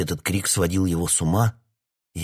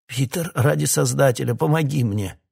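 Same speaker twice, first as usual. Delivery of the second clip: desperate plea; 8 semitones higher, 4 dB louder than usual. A narrator's reading. Hawkins begs Peter.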